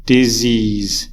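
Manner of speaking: disease, with SEASE clearly stressed; stress on second syllable. Shown as a demonstration. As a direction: model disease for the class, di-SEASE. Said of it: "It's like this".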